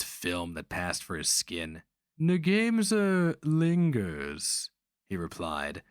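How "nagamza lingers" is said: slow, high, savoring the name; smiling relish. A narrator's reading. Sorcerer smiles at the memory of the killing, slow relish. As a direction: smile as you linger on it. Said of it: "smiling as if at the memory".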